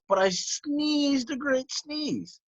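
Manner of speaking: high and slow; dramatic sneeze line. A narrator's reading. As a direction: stretch out the big sneeze. Wolf tells of his sneeze.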